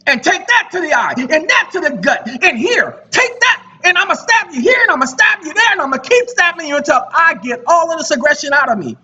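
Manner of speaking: high-pitched, frantic stabbing attack; mock aggression. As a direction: act out someone venting wild aggression. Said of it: "That's how you guys are contacting them".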